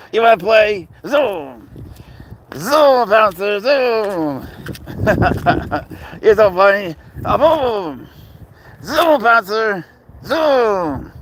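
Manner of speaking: high, loud and bouncy, egging the cat on; excited high voice calling the serval to zoom; playful pet talk. Real, not acted.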